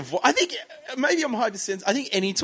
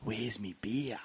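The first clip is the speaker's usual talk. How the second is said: low, soft, slow toy voice; talking Booney toy; 3 semitones lower, 6 dB quieter, slower than usual. Retold or imitated. Mocking the cricketer's talking toy, slow and low. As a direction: do a sluggish talking doll. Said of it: "talking Booney ... and it goes".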